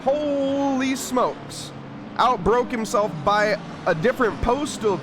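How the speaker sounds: high, slow drawn-out amazement; astonished. Real, not acted. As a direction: stretch it out in disbelief. Near crash called live.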